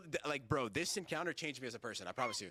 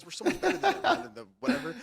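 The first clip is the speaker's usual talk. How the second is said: laughing mock rant; 12 semitones higher, 9 dB louder than usual. Retells his angry rant, laughing.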